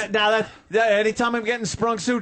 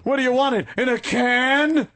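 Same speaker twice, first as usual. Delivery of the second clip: mock bartender; 6 semitones higher than usual.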